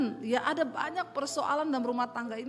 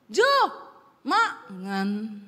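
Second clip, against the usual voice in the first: falling end tone; 3 semitones lower, 5 dB louder, much slower than usual. Shown as a demonstration. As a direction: call his name, then makan with the pitch dropping at the end. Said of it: "Turun langsung".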